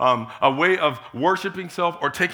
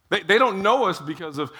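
ordinary talk, nothing marked

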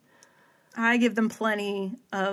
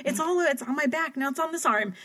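high, frantic imitation of panic; freaking out; 5 semitones higher than usual. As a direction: squeal it out like bugs are crawling on you. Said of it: "freaking out".

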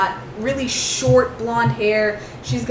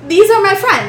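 Lily introducing; 8 semitones higher, 7 dB louder than usual. Acted.

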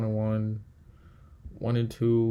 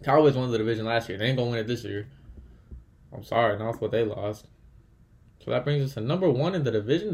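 normal talking voice

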